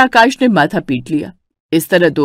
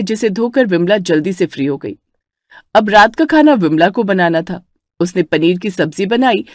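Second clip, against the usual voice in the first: ordinary delivery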